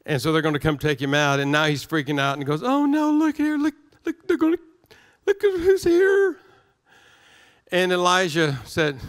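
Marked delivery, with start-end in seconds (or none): 2.6-6.4 high, frantic cry of alarm; shrill with panic, pointing at the army; panicked servant. Acted. Panicked servant voiced in story.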